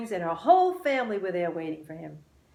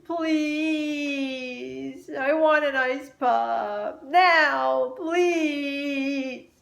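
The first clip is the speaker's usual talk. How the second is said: high, loud pleading whine; tearful child's whine for an ice pop; whining child; 7 semitones higher, 6 dB louder, much slower than usual. A narrator's reading. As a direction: beg like a whiny little kid. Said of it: "Luke whined".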